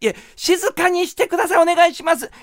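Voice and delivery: high, strained receptionist plea; urgent pleading. Retold or imitated. Receptionist's plea grows more urgent.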